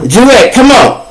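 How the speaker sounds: mock guard calling him out of the cell. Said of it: "and I'm finna".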